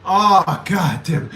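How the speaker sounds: ordinary delivery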